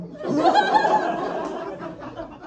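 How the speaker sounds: very high squeal of laughter. Real, not acted. Comic squealing with laughter at the question. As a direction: shriek with laughter.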